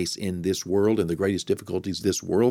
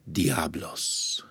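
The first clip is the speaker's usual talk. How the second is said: diablos said the Spanish way, dee-AH-blos; Spanish accent; 4 semitones lower, 6 dB quieter than usual. Shown as a demonstration. Pastor trying the word in a Spanish accent. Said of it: "I'm saying it with a Spanish accent".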